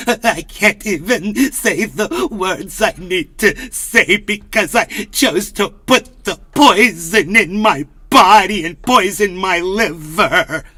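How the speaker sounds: slow, strained whimpering voice; mock wispy voice. Mocking Kennedy to the chat in a slow wispy voice. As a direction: drag it out like a frail old man.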